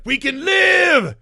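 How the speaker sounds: imitating Achilles with a slow hammed-up shout; slow mock hero shout. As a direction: stretch the yell out like a melodramatic hero. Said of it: "he's like".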